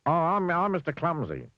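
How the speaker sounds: high, grinning stammered intro; grinning Mister Clumsy. Acted. Mister Clumsy introducing himself to Fussy, grinning. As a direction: beam as you introduce yourself.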